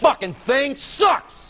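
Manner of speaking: loud, slow, spat-out curse; angry outburst. Real, not acted. Anchor cursing the broken teleprompter, slow angry outburst. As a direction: vent at the machine.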